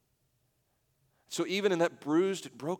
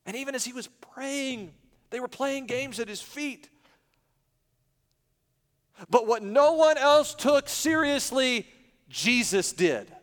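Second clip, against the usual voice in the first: high pulpit voice, building louder and slower; raised, emphatic sermon delivery; impassioned preaching; 9 semitones higher, 6 dB louder than usual. Real, not acted. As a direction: build to a forceful declaration. Preaches at full force.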